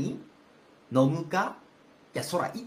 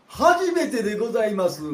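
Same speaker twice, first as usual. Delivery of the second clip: astonished; 6 semitones higher, 9 dB louder than usual. Acted. Servant reacts to rotten tofu.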